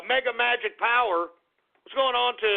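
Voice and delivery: normal talking voice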